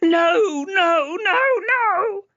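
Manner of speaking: high, loud, tearful screaming; girl sobbing and screaming with her ears covered; crying screams. A narrator's reading. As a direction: wail no over and over. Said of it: "She screamed and covered her ears".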